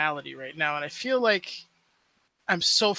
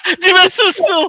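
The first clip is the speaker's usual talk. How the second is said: high, loud laughing voice; 14 dB louder than usual. Real, not acted. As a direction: laugh while you say it. Laughing at trapped children.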